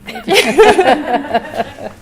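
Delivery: high-pitched chuckling; amused laugh. Real, not acted. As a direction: burst into a light, high laugh. Panel laughs after her answer ends.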